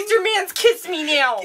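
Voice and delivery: high, put-on girly voice; hammed-up lovesick squeal; mock swooning heroine. Co-host joins the Felicity bit.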